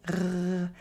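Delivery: "r" gargled softly at the back of the throat. Shown as a demonstration. Teacher demonstrating French R, soft gargled "r". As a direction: hold a quiet gargle on "r", mouth slightly open. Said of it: "as a gargling exercise".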